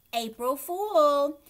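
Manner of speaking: slow, loud teasing singsong; slow loud gloating tease; teasing April Fool. A narrator's reading. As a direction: stretch it out to rub the prank in. Patty finishing the prank.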